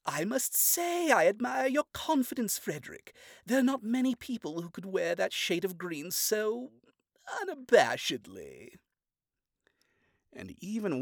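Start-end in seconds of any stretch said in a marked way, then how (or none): 0.0-8.8 backhanded compliment. Acted. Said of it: "offer a backhanded compliment".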